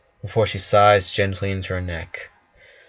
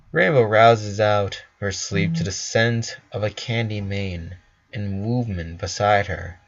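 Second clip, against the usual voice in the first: ordinary delivery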